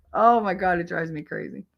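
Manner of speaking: ordinary delivery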